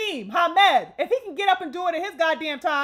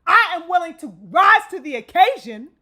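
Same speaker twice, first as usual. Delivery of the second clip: much louder, emphatic imitated declaration; declaring resolve; 3 semitones higher, 9 dB louder than usual. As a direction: proclaim it firmly, as a quoted vow. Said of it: "but saying nonetheless".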